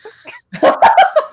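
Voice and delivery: high, loud burst of laughter; shrieking laugh. Real, not acted.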